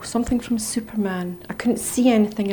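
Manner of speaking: ordinary delivery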